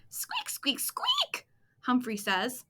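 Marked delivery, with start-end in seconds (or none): 0.1-1.5 very high, soft, breathy squeaks; hamster squeaks. Acted. Humphrey squeaks.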